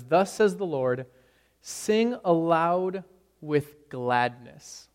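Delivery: slow, solemn reading of the text; scripture reading. Acted. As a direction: read the verse with slow solemnity. Reads scripture slowly.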